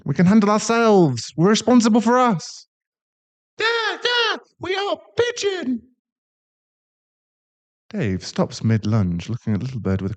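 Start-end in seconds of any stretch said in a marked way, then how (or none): 0.1-2.7 higher, mocking sing-song echo of responsible. Son mocks in higher voice. 3.6-5.8 very high, eager puppy voice; excited dog boy. Acted. Dog boy excited about pigeons.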